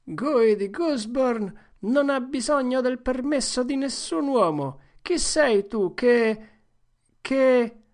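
stately play-acting that stumbles; boy playing a proud knight. Joe plays Guy of Guisborne. (Joe fa Guy di Guisborne, tono solenne)

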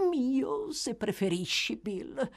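put-on high, wheedling old schemer. A narrator's reading. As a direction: give in with oily deference.

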